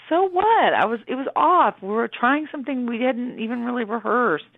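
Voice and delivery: high-pitched, unapologetic comeback; defiant retort. Retold or imitated. Singer answering critics after the Grammys glitch, unapologetically defiant. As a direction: brush off the fuss, starting high and emphatic.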